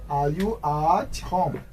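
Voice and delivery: "Are you at home" drawn out, falling at the end; slow model question. Shown as a demonstration. Teacher repeating "Are you at home" for learners, slow.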